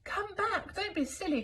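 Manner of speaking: high calling voice; calling after them. Acted. Elephants calling after Elmer, high and pleading. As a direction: call them back from the cave. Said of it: "called the elephants".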